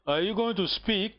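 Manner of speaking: ordinary talk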